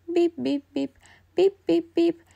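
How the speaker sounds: plain reading voice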